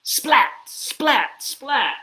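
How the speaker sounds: loud, high, sharp splats; lightning splats. Acted. Reader makes lightning for kids, sharp loud splats. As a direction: crack each one out like lightning. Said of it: "He makes lightning".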